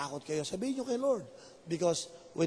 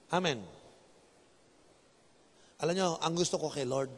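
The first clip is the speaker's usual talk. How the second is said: ordinary talk, nothing marked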